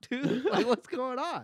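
confused shopkeeper. Retold or imitated. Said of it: "Pakistani guy ... was like".

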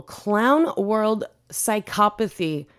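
ordinary talk, nothing marked

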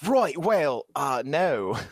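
exaggerated Australian vowels on a reply; Australian accent. Shown as a demonstration. Co-host asked for an accent, mock Australian. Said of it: "could you do an Australian accent".

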